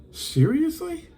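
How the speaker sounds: high, incredulous seriously; can't believe this happened; exasperated disbelief. Real, not acted. Exasperated over eBay closing case.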